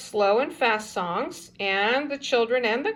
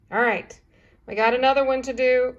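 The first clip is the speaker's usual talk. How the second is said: normal talking voice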